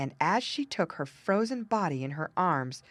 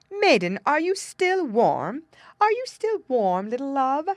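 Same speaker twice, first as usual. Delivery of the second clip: insistent King Frost; 8 semitones higher, 7 dB louder than usual. A narrator's reading. King Frost asking the last time, high insistent voice. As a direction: press her one last time, pitched up and loud.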